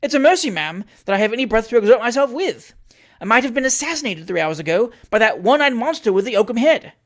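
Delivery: high, loud scolding rant; indignant tirade. Acted. Trott blaming the landlady for the madman, loud angry scolding. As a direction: pour out outrage at the landlady.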